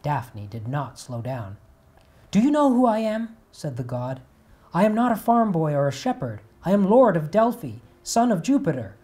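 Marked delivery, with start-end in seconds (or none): 2.3-9.0 much higher, louder proud declaration; loud boasting. Acted. Apollo proclaiming himself to Daphne, loud and proud. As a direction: announce your greatness to make her stop.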